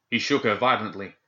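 ordinary delivery

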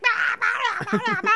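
high, loud nonsense yelling; hammed-up high-pitched blaring; mock shouting. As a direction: blare meaningless syllables as an imitation of shouting. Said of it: "just start shouting".

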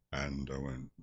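usual speaking voice

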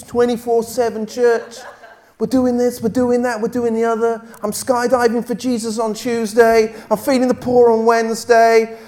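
high, breathlessly eager parody of church busyness; overexcited busy churchgoer. Acted. Churchgoer boasting of a twenty-four seven church, overexcited.

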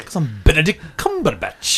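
mock-epic grand actor delivery; lightly hammed-up, portentous self-announcement; Benedict Cumberbatch. Actor explaining why he says it that way, mock epic. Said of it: "so epic".

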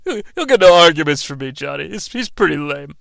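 ordinary delivery

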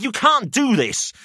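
high, pressing plea; urgent protest. A narrator's reading. Narrator urging Jamie to stop, high pleading. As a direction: insist he cannot do it.

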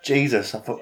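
ordinary talk, nothing marked

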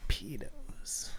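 sultry whispered refusal; breathy whisper. Pretend. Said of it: "a lot of fucking heavy on that".

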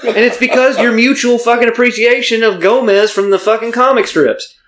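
high-pitched, half-laughing tirade; playful outrage building on one breath; exasperated rant. Real, not acted. Teasing rant over casting.

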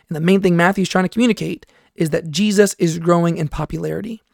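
ordinary talk, nothing marked

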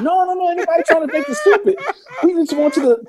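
high, fast, laughing through it; laughing reassurance. Retold or imitated. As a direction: reassure her while cracking up.

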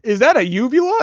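oblivious diver. Retold or imitated. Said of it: "It's like".

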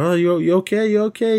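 high-pitched, fussing concern in a put-on mild voice; Clark. Podcaster as Clark checking on dazed woman, high fussing concern. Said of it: "gets into Clark mode".

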